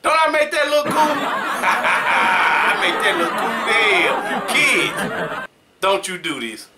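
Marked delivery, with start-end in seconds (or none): 0.0-5.4 laughing. Real, not acted. Showing off a vape cloud.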